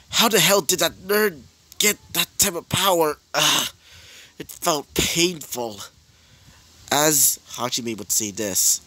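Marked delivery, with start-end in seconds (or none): 0.1-5.8 mild angry Bakugo. Acted. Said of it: "As Bakugo would say this".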